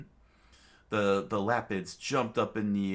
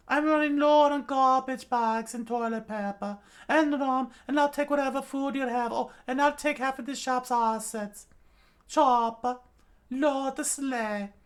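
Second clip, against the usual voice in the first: put-on old witch voice; 8 dB louder than usual. A narrator's reading. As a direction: talk like a sly old witch doctor.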